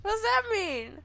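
loud, high, laughing bafflement; baffled outburst. Real, not acted. Player reacts to a joke she read, loudly baffled. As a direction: burst out in amused disbelief.

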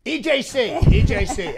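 loud punchy E J C; loud repeat. Acted. President loudly repeating the initials back. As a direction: bark the initials back happily.

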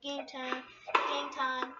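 ordinary talk

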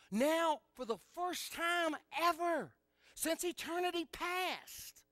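high, building sermon cadence; full-force preaching. Real, not acted. Preacher on Christ's eternal fellowship with the Father, impassioned preaching.

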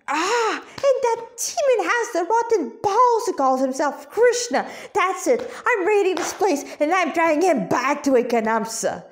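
seethe as you storm off; hissed in fury. Acted. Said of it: "Radha hissed".

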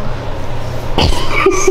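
sudden high-pitched giggle. Real, not acted. Giggles while playing teacher.

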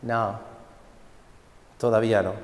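a calm, quiet no; calm master. Acted. The smiling master answers the cup, low and soft. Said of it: "y me decía".

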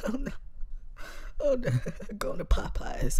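high, breathy mock clown voice; clown refusing fry. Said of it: "And he said".

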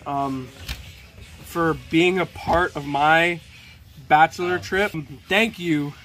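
formal sign-off. Acted. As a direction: address the viewers grandly. Sign-off to viewers.

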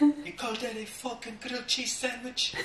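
high mock chef yelling in a put-on accent; high hammed-up chef rant; screaming TV chef. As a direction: ham up an angry celebrity chef. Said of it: "It's fucking foreign accent".

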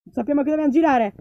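ordinary delivery

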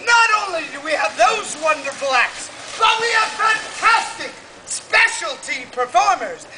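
booming showman announcement; carnival barker. Acted. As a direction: sell it to the crowd. Fair barker announcing acts.

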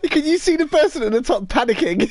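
high, amused laughing question. Real, not acted. Player pointing out panicking enemy to friend, laughing. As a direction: barely get it out for laughing.

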